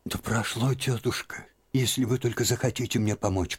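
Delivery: lively, lifted plea; sudden animation. A narrator's reading. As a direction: brighten up and plead. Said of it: "с внезапным одушевлением". (Лиза с внезапным оживлением просит тётку)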